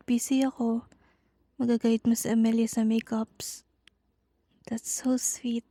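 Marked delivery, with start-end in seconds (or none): 4.7-5.7 tender whisper to her husband; soft unvoiced whisper; whispering to husband after his sweet gesture. Real, not acted.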